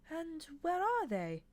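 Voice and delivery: high, slow, sugary angel voice; the angel coaxing the hypnotised nun, sweetly. Acted. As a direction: ask it sweetly and slowly. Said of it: "said Aziraphale sweetly".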